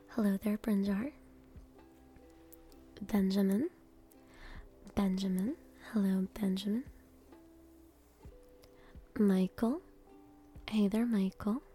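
normal talking voice

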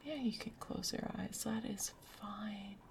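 usual speaking voice

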